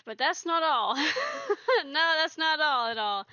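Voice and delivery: loud laughing burst. Real, not acted. Teasing the viewers about what comes next, laughing.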